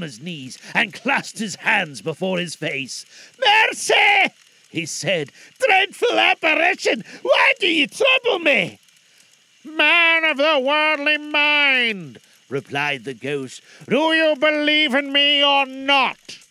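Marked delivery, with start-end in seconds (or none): 3.4-8.8 high, loud cry for mercy; terrified shout. Acted. Scrooge on knees, terrified. 9.6-16.4 slow, loud, hollow spectre; stern ghost voice. Acted. Ghost demands belief.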